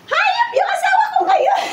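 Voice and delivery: high shriek breaking into laughter; shocked outburst. Acted. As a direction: shriek it in comic panic, then crack up. Panic on finding husband at door. (patiling gulat na natatawa)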